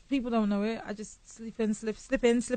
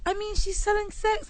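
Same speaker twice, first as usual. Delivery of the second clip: shocked critic; 12 semitones higher, 5 dB louder, slower than usual. Retold or imitated. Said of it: "thirty percent are like".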